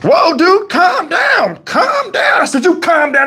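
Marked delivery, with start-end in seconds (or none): 0.0-2.4 high-pitched, alarmed imitation of a cop; retold plea to settle down, pitched way up; urgent calming down. Officer to irate driver he pulled over, urgent calming down. 2.6-3.3 high, heated retold comeback; heated retort. Driver back at the officer in a retold quarrel, heated retort.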